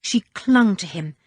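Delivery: ordinary delivery, nothing marked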